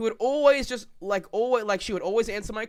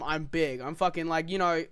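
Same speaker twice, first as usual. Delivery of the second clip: ordinary delivery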